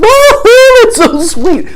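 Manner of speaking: high, loud giddy gush; gushing delight. Retold or imitated. Two women delighted over the engraving, high loud gushing. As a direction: squeal it through the laughter. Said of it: "between laughing about how easy".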